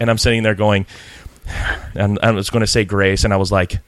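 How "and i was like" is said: usual speaking voice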